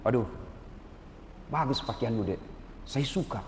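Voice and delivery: soft, kindly rebuke; gentle correction. Retold or imitated. Lecturer gently sending a badly dressed student out, soft and kind. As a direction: let the student down gently, no harshness. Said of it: "Tapi saya tidak kasar ngomong".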